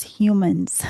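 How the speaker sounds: usual speaking voice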